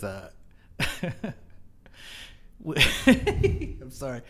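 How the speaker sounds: ordinary delivery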